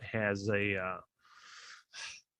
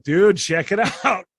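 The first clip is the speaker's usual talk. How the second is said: slow, loud and higher, laughing through it; excited call to buddy; 5 semitones higher, 8 dB louder than usual. Retold or imitated. Texting a buddy a funny screenshot, excited laughing call. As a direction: hype up the buddy over a funny find. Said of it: "be like".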